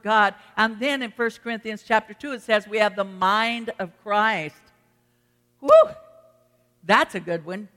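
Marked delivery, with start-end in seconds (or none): 5.6-5.7 short, real whoop of excitement; sudden whoop. Real, not acted. Preacher relishing a good scripture, sudden whoop. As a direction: let out a quick "woo". Preacher exclaims at own point.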